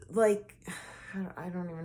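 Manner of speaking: breathy sigh into a whisper; exhale, unsure how to go on. Real, not acted. Sighing, lost for words.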